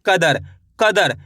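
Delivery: "कदर, कदर" stretched out slowly, syllable by syllable; slow syllable demo. Shown as a demonstration. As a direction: drag out each sound of "कदर" for the pupil. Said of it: "कदर बोलो इस तरीके से".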